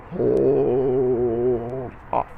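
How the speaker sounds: long droning "Hold" with a cut on "off"; held count. Shown as a demonstration. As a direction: stretch "Hold" very long on one steady note, then "off". Counting out the final fermata.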